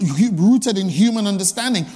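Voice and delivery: ordinary talk, nothing marked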